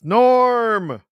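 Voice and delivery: loud, high bar crowd shout of Norm; Norm callout. Pretend. Co-host shouting Norm like the Cheers crowd. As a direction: greet him like the whole Cheers bar.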